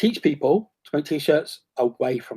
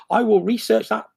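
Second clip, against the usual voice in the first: usual speaking voice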